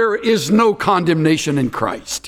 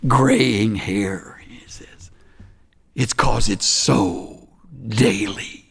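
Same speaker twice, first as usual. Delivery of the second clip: oude wijze man; 7 semitones lower, slower than usual. Retold or imitated. Quotes old wise man slowly, quietly.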